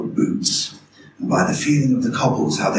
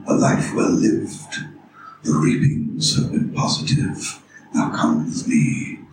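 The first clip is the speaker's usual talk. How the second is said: grim reaper voice; 6 semitones lower than usual. Retold or imitated. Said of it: "He sort of took it around here".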